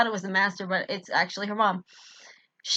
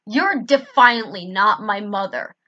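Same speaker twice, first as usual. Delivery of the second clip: growling accusation; 8 dB louder, slower than usual. A narrator's reading. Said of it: "I growled".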